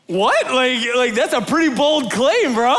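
high-pitched, acted disbelief; incredulous. Acts out his stunned reaction.

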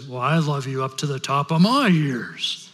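put-on outdo the child in a big-daddy voice; father rabbit. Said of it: "And the father rabbit says".

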